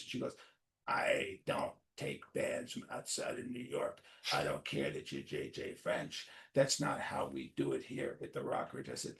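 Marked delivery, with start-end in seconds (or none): 0.9-8.9 slow, breathy, stuck-up refusal; snooty club booker. Retold or imitated. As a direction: mimic the booker brushing him off.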